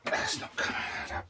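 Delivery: a frustrated whisper under the breath; whispered to himself. Real, not acted. Man fighting a tight bolt, whispering in frustration. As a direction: hiss it quietly at the stuck bolt.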